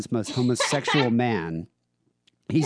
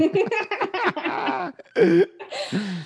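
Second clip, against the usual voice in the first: high squealing laugh. Real, not acted. Laughing at crude listener email.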